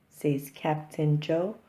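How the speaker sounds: usual speaking voice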